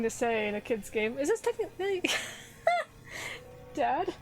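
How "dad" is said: normal talking voice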